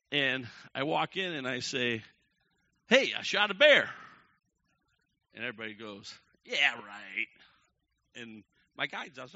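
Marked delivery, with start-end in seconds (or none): 2.9-3.9 loud, high call to the room; proud announcement. Retold or imitated. Announcing the kill to cabin. 6.4-7.3 loud, dismissive reply; sarcastic disbelief. Retold or imitated.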